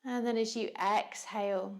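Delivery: calm, unhurried yoga teacher voice with long pauses; measured meditation cueing, leaving room to breathe; guided meditation. Real, not acted.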